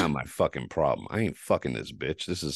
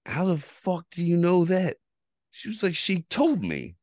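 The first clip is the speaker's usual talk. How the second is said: much higher, incredulous; shocked disbelief; 14 semitones higher than usual. Retold or imitated. Asking his mother how she knew, shocked. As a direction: sound caught off guard.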